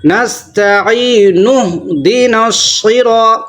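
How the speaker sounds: slow نستعین اہدنا الصراط with heavy ص; slow tajweed; correct recitation. Shown as a demonstration. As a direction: recite with a full thick ص. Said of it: "صحیح".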